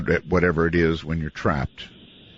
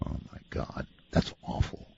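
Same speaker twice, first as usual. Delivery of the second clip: shocked whisper; 12 dB quieter than usual. Real, not acted. Reacting to creepy EVP.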